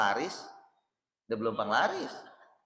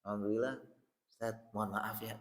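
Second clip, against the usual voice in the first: a hint of a timid guest, softer; humble guest; 2 semitones lower, 8 dB quieter than usual. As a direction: apologise meekly, almost under the breath. Guest humbly asking ustaz.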